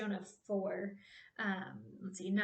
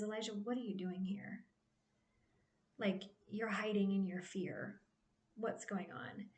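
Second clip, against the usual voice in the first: much softer, hushed tender question; compassionate God; 7 dB quieter than usual. Retold or imitated. God softly asking the scared Elijah in the cave, tender and hushed. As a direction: ask gently, like soothing a frightened friend. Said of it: "the compassion that God shows to him here".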